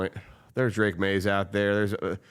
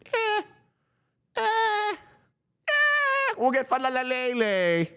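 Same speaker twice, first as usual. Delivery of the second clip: mocking front office; 14 semitones higher than usual. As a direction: ham up a clueless team boss picking players. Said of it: "and we just said".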